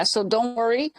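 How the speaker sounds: ordinary talk, nothing marked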